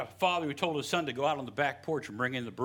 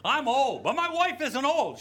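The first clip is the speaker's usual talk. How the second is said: higher, louder hammed-up old husband; mock protesting old man; 10 semitones higher, 6 dB louder than usual. Preacher hamming up Zechariah insisting his wife isn't old, higher and louder.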